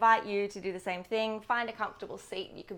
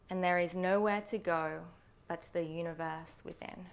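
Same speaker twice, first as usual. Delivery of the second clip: guided meditation. Acted. Yoga teacher settles students into breath before practice, guided meditation voice. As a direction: lead them gently inward, phrase by phrase, unhurried.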